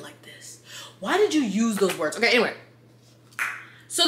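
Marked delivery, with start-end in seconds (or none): 0.0-0.6 whispering. Real, not acted. Exasperated aside to the author.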